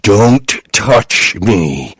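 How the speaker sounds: slow possessed growl; demon growl. A narrator's reading. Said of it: "she growled, in the best impression ... demon from The Exorcist".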